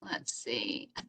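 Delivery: whispered aside to herself; breathy unvoiced mutter; whispering. Real, not acted. Muttering to herself while searching.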